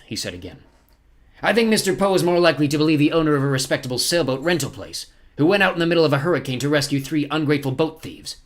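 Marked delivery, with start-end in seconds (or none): 1.4-8.4 higher, louder scornful villain; scornful villain captain. Acted. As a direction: sneer at the children's story.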